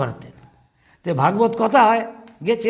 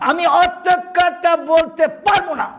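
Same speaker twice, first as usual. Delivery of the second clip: much higher, louder, slow irritated outburst; annoyed mother; 9 semitones higher, 4 dB louder, slower than usual. Retold or imitated. Old mother fed up with her son's nagging, irritated and loud. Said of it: "মা হঠাৎ রেগে গেছে, বিরক্ত হয়ে".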